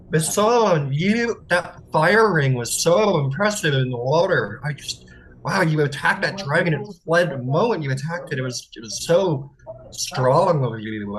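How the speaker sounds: high, gushing, fawning praise; fawning Thalia. Acted. Thalia gushing over Basan in a high voice. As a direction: gush over him in a high flirty voice. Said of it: "Basan goes".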